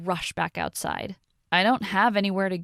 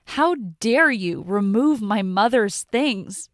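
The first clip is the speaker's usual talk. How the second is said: slow, louder, each word spat out; spitting the words; 3 semitones higher, 6 dB louder, slower than usual. Acted. Daughter spitting words at stepmother, slow and furious. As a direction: spit it out at her in fury. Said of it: "I spit the words out at her".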